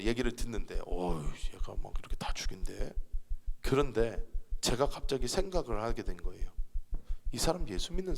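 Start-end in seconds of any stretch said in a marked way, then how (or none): 0.9-2.9 hushed, creeped-out whisper; a frightened little whisper to oneself; uneasy whisper. Retold or imitated. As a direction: whisper it as if unnerved by the threat. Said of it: "약간 섬뜩하더라고요".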